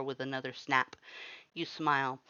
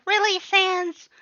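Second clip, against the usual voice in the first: much higher, louder, slow exasperation; exasperated Papyrus; 12 dB louder, slower than usual. A narrator's reading. Papyrus groans at Sans' pun, loud and high. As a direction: drag it out in disbelief.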